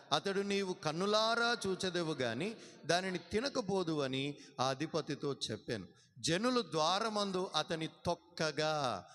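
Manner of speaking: loud, slow, ringing pulpit reading; preaching scripture reading. Acted. Pastor reading the verse aloud to the congregation, loud and slow. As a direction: proclaim each word to the church.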